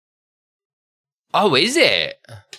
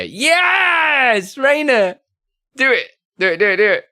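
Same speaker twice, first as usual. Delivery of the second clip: high, drawn-out shout into urging chant; excited cheer; 12 semitones higher, 4 dB louder than usual. Real, not acted. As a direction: egg them on with a high yell. Cheering a viewer on.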